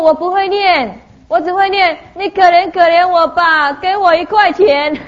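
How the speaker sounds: high, drawn-out mock beggar's plea; imitating begging child. Beggar child asking the speaker for a coin, high pleading.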